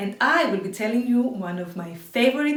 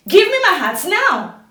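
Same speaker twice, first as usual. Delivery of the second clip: higher, louder demand; angry shout; 7 semitones higher, 11 dB louder than usual. Acted. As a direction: yell up at the monkeys. Man yells at monkeys.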